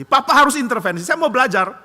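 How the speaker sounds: loud, high pleading; boy yelling at his father to intervene; angry plea. Acted. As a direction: demand his father act. Child begs father to step in.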